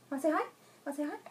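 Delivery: soft, high, rising questions; coaxing pet talk. Real, not acted. Owner coaxing her dog to greet viewers, soft high coaxing. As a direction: coax a pet gently in a small high voice.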